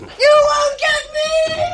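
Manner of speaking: high, loud, slow defiant scream; desperate shout. Acted. Helen screams at the police as she bolts, desperate shout. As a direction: hurl it back at them as you run.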